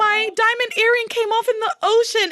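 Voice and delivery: high-pitched whiny fake sob; hammed-up tearful wail, way up high; mock crying. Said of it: "like, crying. She's like".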